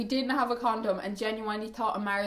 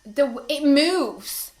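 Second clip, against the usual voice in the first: amazed; 4 semitones higher, 8 dB louder, slower than usual. Real, not acted. Amazed at the toy.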